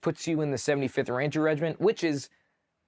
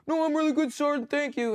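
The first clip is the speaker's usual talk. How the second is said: much higher, chirpy contented reply; happy quitter; 14 semitones higher than usual. Retold or imitated. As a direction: sound cosy and grateful, warm by the fire. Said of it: "He'd be like".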